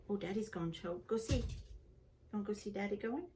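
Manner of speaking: usual speaking voice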